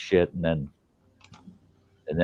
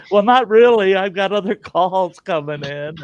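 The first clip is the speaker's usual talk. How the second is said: higher, louder mock agent brushing off the caller; busy agent; 10 semitones higher, 3 dB louder than usual.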